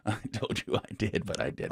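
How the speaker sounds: hushed guilty admission; sheepish confession. Retold or imitated. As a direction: drop to a sheepish near-whisper. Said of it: "I'm like".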